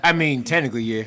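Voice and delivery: loud, high, through real laughter; laughing concession. Real, not acted. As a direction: concede the joke while laughing. Amused concession after drug joke.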